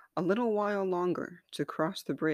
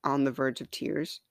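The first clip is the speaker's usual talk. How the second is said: ordinary delivery, nothing marked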